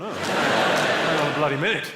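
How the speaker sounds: loud, slow imitated protest; mock objection. Comedian voices audience members objecting, loud mock protest. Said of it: "They've gone".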